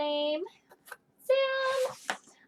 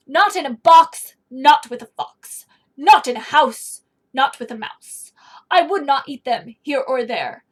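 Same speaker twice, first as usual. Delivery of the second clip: put-on loud, insistent grumpy refusals read to children; 7 dB louder than usual. Teacher reads Grumpy's refusals to the class, loud and stubborn. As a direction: stomp out each refusal like a grumpy creature.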